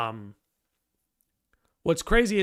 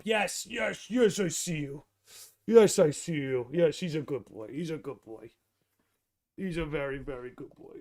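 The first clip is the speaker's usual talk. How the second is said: soft, lower doting praise; 4 semitones lower, 4 dB quieter than usual. Real, not acted. Streamer praising his dog on camera, soft doting croon. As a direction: croon it to the dog, getting quieter.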